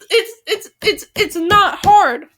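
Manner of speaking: high, halting self-scolding, loud at the end; stammer, then land hard on the last words; exasperated stammer. Real, not acted. Frustrated stammer at own flubs.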